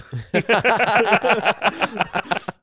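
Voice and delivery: bursting laugh; loud laugh. Real, not acted. Storyteller laughs loudly at the pickle order. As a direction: laugh hard at his own story.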